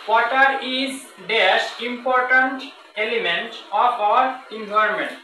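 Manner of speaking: slow dictation, the blank marked by dash; slow example reading. Shown as a demonstration. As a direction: dictate it slowly to students. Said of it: "আরেকটা example দেখেন".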